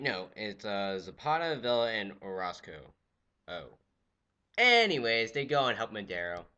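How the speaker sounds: normal talking voice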